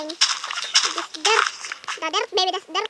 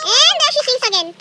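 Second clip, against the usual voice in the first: loud, high exasperated cry; fed-up loud complaint; annoyed outburst; 6 semitones higher, 9 dB louder than usual. Real, not acted. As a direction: complain loudly about her. Annoyed at sister singing.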